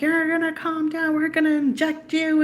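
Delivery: security guards threatening her, in a mocking high voice. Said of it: "and they're like".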